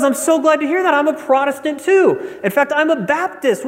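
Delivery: high-pitched eager joke dialogue; acted mounting delight; delighted discovery. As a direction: gush at finding common ground. Joke strangers bond with rising delight.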